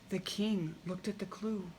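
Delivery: plain reading voice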